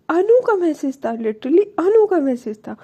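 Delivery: ordinary delivery, nothing marked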